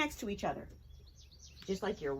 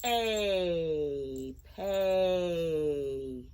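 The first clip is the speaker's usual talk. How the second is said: P-A drawn out sound by sound; segmented slowly; 6 semitones lower than usual. Shown as a demonstration. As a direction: stretch P-A apart. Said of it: "Let's say it slowly".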